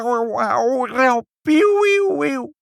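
very high, loud yowling cat; urgent frightened meowing. A narrator's reading. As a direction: wail like an alarmed cat. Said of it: "zo dringend en angstig te miauwen". (dringend, angstig kattengemiauw)

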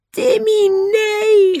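very high, anguished scream. A narrator's reading. George screams for Timmy after the shot, horrified. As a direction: scream in horror after the dog. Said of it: "gilde George".

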